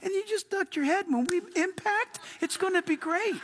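mock-cheerful flight attendant, laughing; confident flight attendant. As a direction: chirp it like unbothered cabin crew. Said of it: "those flight attendants are so confident".

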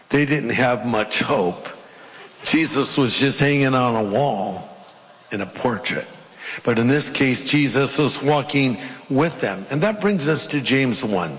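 usual speaking voice

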